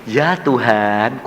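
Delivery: slow, drawn-out reply with rising end; imitated slow, submissive address; reverent answer. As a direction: stretch it out devoutly to the Lord. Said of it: "saya ngomongnya gini".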